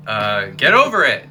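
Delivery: dismissive. Real, not acted. Dismissing the critics of drag.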